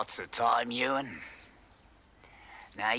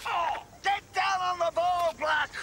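furious shouting. Acted. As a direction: scream the orders at the players. Coach yelling at rugby player.

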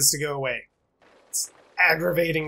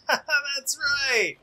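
laughing; 9 semitones higher than usual. Real, not acted. Laughing at game win.

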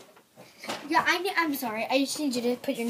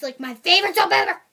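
excited; 5 semitones higher, 12 dB louder, faster than usual. Real, not acted. Loud fast gush.

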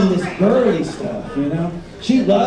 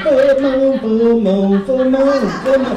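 excited chant, pitched up; imitated excitement; 4 semitones higher, 4 dB louder, slower than usual. Girlfriend celebrating the full moon, imitated excitement. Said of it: "She was so excited".

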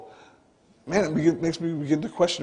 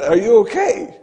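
louder call, slightly raised; father calling to his daughter, raised call; 4 semitones higher, 6 dB louder than usual. Retold or imitated. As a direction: check on her with a raised voice.

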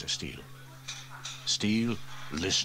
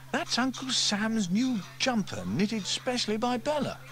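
Sarah voiced much higher, stern and scolding; stern girl; 14 semitones higher than usual. Girl scolds the fire chief for his remark, sternly. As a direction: tell him off like a cross little girl.

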